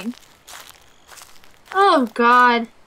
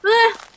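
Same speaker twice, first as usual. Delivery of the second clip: high, short cry of alarm; quick high "ah"; startled yelp; 9 semitones higher, 3 dB louder than usual. Real, not acted. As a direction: yelp in sudden fright. Frightened by shape in game.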